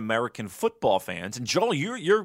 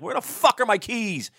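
mock yell; 10 semitones higher than usual. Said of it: "And I'm screaming".

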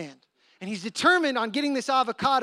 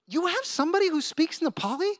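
high-pitched imitation of astonishment; higher voice, marvelling at what they see; amazed onlooker; 8 semitones higher than usual. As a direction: act out an impressed bystander. Soup runner amazed at John.

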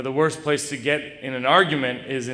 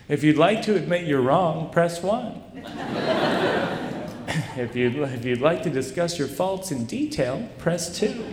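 higher, chirpy recorded-menu voice with a laugh breaking through; automated phone menu; 7 semitones higher than usual. Retold or imitated. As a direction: read it like a cheerful phone hotline. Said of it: "her business voice".

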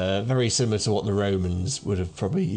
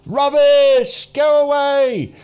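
loud, high, drawn-out jeer; heckling shout; 9 dB louder, much slower than usual. Acted. Crowd rejecting the doomsayer's warning, heckling loudly. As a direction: yell him off the stage. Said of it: "And people are going".

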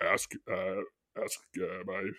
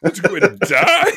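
high, loud laughing reaction; 9 semitones higher, 9 dB louder than usual. Real, not acted. Comic reacting to the cork story, laughing. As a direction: blurt it out through a laugh.

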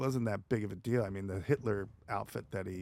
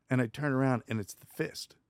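ordinary delivery

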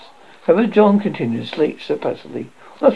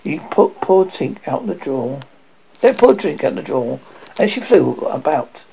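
usual speaking voice

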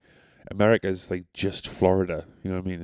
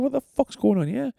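incredulous outburst. Retold or imitated. Shopper seeing two hundred pound tags, high-pitched incredulous reaction. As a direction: squeal it in mock shock at the prices. Acted shock at outlet prices.

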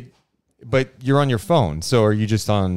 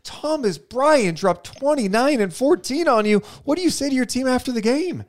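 much higher, pointed reporter question; press conference reporter; 11 semitones higher than usual. Pretend. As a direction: grill the coach at the podium. Host plays reporter grilling coach.

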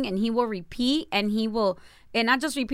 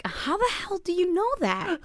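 shocked; 9 semitones higher, 3 dB quieter than usual. Retold or imitated. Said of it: "which I was like".

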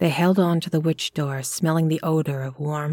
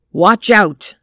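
loud warning shout; 3 semitones higher, 10 dB louder than usual. A narrator's reading. Robert warning Lottie before kicking the door, shouting. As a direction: bark a warning before the kick. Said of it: "Then he shouted".

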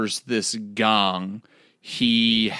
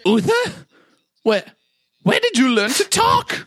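much higher, squeaky astonished wizard voice; amazed wizard; 14 semitones higher, 4 dB louder than usual. Acted. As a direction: marvel at the rat in a high squeak. Wizard amazed at talking rat.

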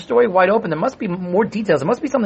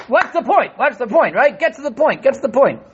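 1 impatient; 7 semitones higher than usual. Acted. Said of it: "I see some people know what I'm talking about".

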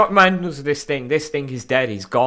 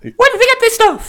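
very high, loud hammed-up wrestler voice; squealed impression; 8 dB louder than usual. Host still mocking the magazine advert, squealed impression. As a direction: keep up the piercing squeal.

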